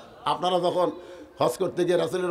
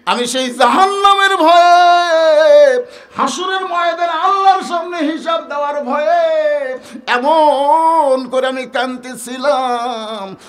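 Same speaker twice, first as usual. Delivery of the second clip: loud, high, drawn-out wail; slow, stretched preacher's outcry; anguished preaching; 11 semitones higher, 11 dB louder, slower than usual. Acted. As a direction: cry the fear out in a sermon chant. Preacher voices fearful weeping confession.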